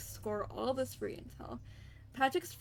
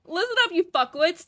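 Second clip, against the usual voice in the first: a hint of a rude boss, louder and higher; mild Kaiser voice; 8 semitones higher, 11 dB louder than usual. First try at the broker's rude greeting, lightly voiced. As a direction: bark it half-heartedly as the info broker. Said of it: "like, nasally voice".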